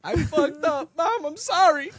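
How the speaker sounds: high, loud mock wail; imitated bawling. Son confessing to mom, imitated bawling. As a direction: cry out the confession, hammed up. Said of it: "I was crying".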